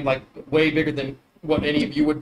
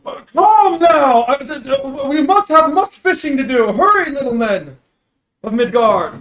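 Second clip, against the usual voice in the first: loud, high, hearty giant calling down; booming giant voice; 10 semitones higher, 6 dB louder than usual. Acted. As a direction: bellow like a jolly sea giant. GM voicing the giant.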